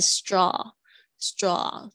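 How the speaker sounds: "straw" drawn out very slowly with an open ah vowel, not O; teacher modelling the AW in "straw"; slow model pronunciation. Shown as a demonstration. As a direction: open the mouth slightly for a long "straw". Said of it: "啊的声音，它是不是发O的声音".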